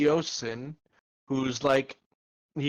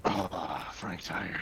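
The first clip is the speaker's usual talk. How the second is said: low, quiet, tired giant voice; deep weary groan of words; weary undead giant; 9 semitones lower, 7 dB quieter, much slower than usual. Acted. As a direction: sag into a simple, worn-out giant. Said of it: "He's like".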